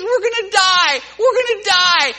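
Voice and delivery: put-on boy crying for daddy in the storm; crying child. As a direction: play the scared little son calling his dad. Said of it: "He says".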